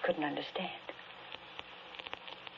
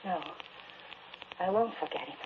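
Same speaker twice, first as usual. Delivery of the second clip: usual speaking voice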